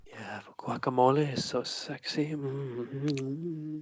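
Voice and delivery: breathy mock seductive whisper; sultry whisper. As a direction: whisper it like a come-on.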